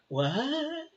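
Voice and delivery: a sudden high, quiet what; surprised what. Retold or imitated. Toxic friends shocked at being cut off, high surprised what. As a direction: sound caught off guard, pitch jumping up.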